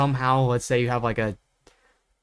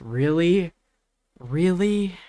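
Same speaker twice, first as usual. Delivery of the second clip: drawn-out, higher-pitched disbelief; incredulous; 5 semitones higher, slower than usual. Real, not acted. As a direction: ask it like you cannot believe it. Incredulous at using a weaker character.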